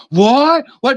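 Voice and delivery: sharp, high and loud yelp; paranoid startle. Retold or imitated. Delivery guy caught by the dog, loud startled yelp. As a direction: jump like you've been caught. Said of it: "He got all paranoid".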